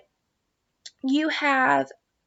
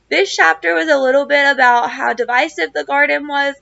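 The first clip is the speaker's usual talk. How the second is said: ordinary talk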